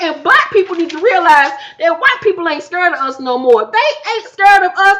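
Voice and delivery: high, fired-up rant; heated rant. Real, not acted. Vlogger ranting to viewers about Mariah, high and fired up. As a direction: build it up hot and insistent.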